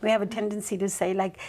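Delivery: normal talking voice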